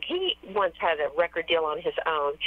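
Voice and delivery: ordinary delivery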